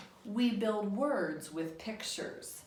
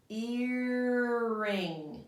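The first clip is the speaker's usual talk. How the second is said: Earring drawn out as one smooth word; Earring said slowly as one word, not two; blended word; 3 semitones higher, 5 dB louder than usual. Shown as a demonstration. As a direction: glide the two parts together. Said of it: "We just look at the pictures".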